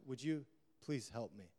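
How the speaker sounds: a worn-out, softly acted appeal; desperate plea. Desperate man asking the outcast for help, subdued plea. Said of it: "in his desperation, the man cries out".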